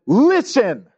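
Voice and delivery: a stern parent's listen, high and pushed; parent's command. Retold or imitated. Mother or father ordering a child, stern listen. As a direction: order the child to pay attention. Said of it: "your mother or your father says".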